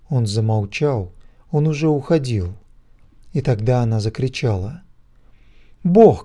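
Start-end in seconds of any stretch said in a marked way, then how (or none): 5.8-6.2 a loud, much higher scream of the name; shout. A narrator's reading. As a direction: scream it out to stop him. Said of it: "кричала она". (громкий крик)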